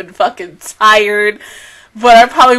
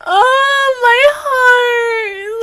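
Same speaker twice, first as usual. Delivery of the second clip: high, drawn-out tearful wail; 11 semitones higher, much slower than usual. Real, not acted. Fan overcome by her favorite characters' reunion, tearful. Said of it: "I'm not okay".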